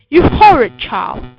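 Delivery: loud scolding outburst; angry cry. A narrator's reading. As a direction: cry out like an outraged mother. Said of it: "my mother cried".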